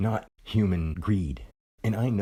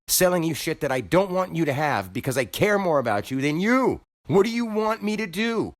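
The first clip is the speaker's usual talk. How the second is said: heated outburst; 8 semitones higher than usual. Real, not acted. Venting to viewers about the angry shoppers, rising exasperated. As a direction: voice climbing much higher in exasperation. Ranting at complaining customers.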